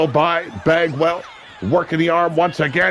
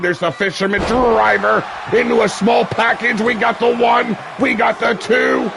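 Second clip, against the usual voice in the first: excited count; 7 semitones higher than usual. Real, not acted. Near fall count.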